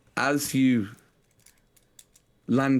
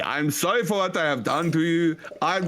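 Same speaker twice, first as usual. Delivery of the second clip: mock German accent, a little higher; 6 semitones higher than usual. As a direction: plead in a hammy German voice. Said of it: "This is a German accent".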